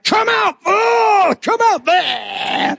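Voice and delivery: hammed-up cry of come out, dragged out; mock deliverance shout. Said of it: "Some of you are like".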